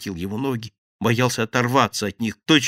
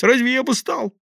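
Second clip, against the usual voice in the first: high, loud eager babble; relieved babbling; 11 semitones higher, 5 dB louder than usual. A narrator's reading. Thief eagerly pleads with the gang. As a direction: chatter on in relief. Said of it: "обрадованно залепетал".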